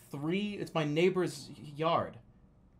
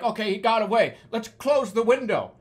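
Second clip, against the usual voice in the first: higher, louder mother's voice; lightly voiced mother; 7 semitones higher, 7 dB louder than usual. Mother telling family to shut out the tiger, lightly acted urgency. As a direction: lightly act out a worked-up mom.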